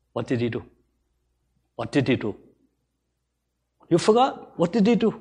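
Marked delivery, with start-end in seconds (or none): none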